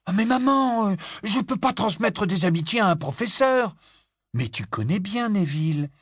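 put-on high loud whiny boy; exasperated teen. A narrator's reading. Boy embarrassed by his mother, high exasperated whine.

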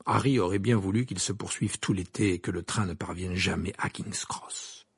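ordinary delivery, nothing marked

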